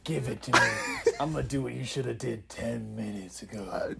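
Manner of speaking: menacing, voiceless whisper; creepiest criminal. Acted. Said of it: "Talk about the creepiest criminal".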